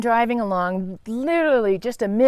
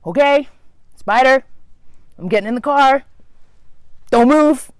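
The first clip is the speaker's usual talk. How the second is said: louder, higher call to the spider; firm warning; 5 semitones higher, 5 dB louder than usual. Retold or imitated. She warns the spider in her car, loud and firm. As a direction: warn the spider loudly and firmly.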